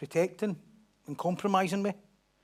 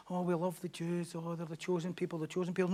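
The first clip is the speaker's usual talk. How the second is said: 1 mock gushing; 10 dB quieter than usual. Mocking overexcited Christians.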